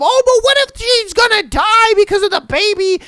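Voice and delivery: high, loud hysterical mimicry; mock panic. Mocking people who give extreme abortion examples, mock panic. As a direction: wail it in an exaggerated mocking voice.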